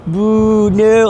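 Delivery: mock audience boos; heckling audience. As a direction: call it out high like a jeering crowd. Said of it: "the audience is like".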